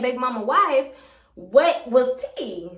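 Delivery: ordinary talk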